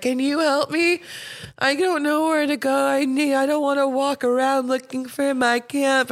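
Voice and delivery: a hint of a drunk girl begging for help.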